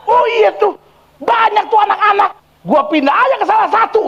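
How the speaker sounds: very high shrieked demon voice; gloating demon. Retold or imitated. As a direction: taunt the exorcist as a possessed man. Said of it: "Tiba-tiba dia gini". (suara setan mengejek, sangat tinggi)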